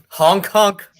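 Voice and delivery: high, loud clown horn honk; silly clown honk. Pretend.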